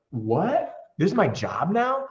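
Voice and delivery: high rising amazed what; a rookie stunned by his good luck; amazed disbelief. Retold or imitated. New roofer marvels at approval, high amazed voice. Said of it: "how it feels to be like".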